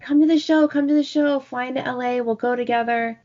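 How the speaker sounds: high, urging sing-song of an eager friend; eager, high-pitched pleading; coaxing friend. Retold or imitated. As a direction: imitate a friend egging you on, pitched well up. Friend coaxing her in story.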